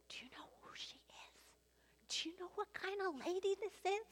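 hushed, mock-scandalized gossip whisper. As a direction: whisper it like a shocked onlooker, rising in pitch. Pharisees gossiping about the woman.

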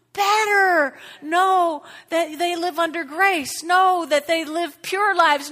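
loud, high, crying-out prayer at full force; fervent prayer. Acted. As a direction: call out to God with everything. Pastor prays aloud over congregation.

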